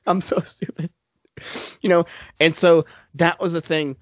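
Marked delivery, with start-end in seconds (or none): 0.1-0.9 regretful inner voice. Retold or imitated. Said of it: "you're like".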